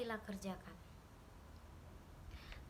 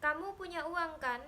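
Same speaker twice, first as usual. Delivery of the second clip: higher, louder angry demand; husband's raised, demanding tone; 5 semitones higher, 5 dB louder than usual. A narrator's reading. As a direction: press for the money in a raised voice. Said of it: "Dodi berbicara dengan nada tinggi".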